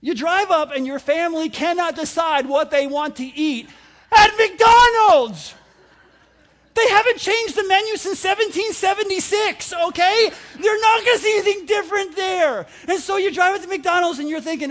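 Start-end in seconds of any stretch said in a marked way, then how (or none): 4.2-5.5 slow, high, loud exasperated cry. Acted. Preacher venting about family ordering, drawn-out loud cry. 6.8-12.7 high, loud exasperated rant; mock rant. Pastor ranting at congregation about the menu, loud and high. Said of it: "ranting about menu".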